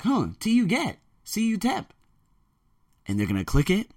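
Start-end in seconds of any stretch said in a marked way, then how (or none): 0.0-1.9 high, puzzled student voice; a high, curious "huh" from a student. Retold or imitated. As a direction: students spotting the ad. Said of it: "they're gonna be like".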